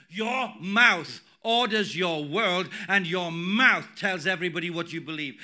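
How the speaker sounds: loud, forceful declaration, weight on each key word; emphatic preaching. Real, not acted. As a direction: drive it home from the pulpit, loud and deliberate. Preacher driving home his point.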